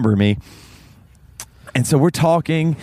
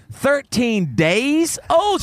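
incredulous question; 10 semitones higher, much slower than usual. Retold or imitated. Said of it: "I said, I said".

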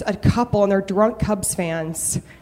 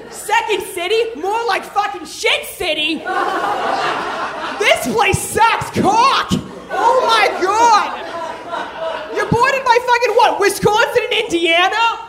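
put-on drunk Boston accent rant; 9 semitones higher than usual. Comic ranting at Chicago couple in Boston accent. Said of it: "I slip into a Boston accent".

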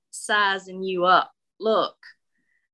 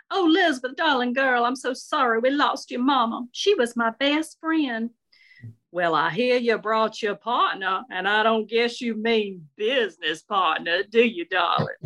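higher-pitched Southern matron, full of sympathy and nosiness; effusive older Southern lady; 4 semitones higher than usual. Acted. Shirley greets bereaved friend's daughter at funeral, effusive Southern lady. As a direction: play the fond, unfiltered old Southern friend.

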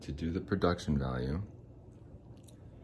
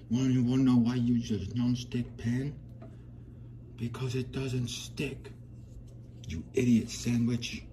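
higher-pitched English chef impression; Gordon Ramsay; 6 semitones higher than usual. Scolding a cook about a nonstick pan, high English chef impression. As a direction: put on an indignant English celebrity chef. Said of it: "to quote Chef Gordon Ramsay".

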